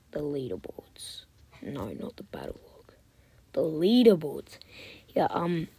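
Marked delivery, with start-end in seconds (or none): 0.1-2.9 soft, hushed, to herself; muttered whisper. Real, not acted. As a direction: mutter while clicking. Muttering while navigating menus.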